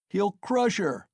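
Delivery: high alarmed cry from the crowd; shouted. A narrator's reading. Bystanders crying out as Mikolka raises the shaft, alarmed. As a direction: yell a warning. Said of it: "was shouted round him".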